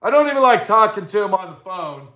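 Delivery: ordinary talk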